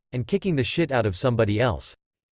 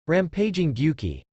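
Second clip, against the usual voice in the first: calling out; 4 semitones higher than usual. A narrator's reading. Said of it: "Naruto called out the attack".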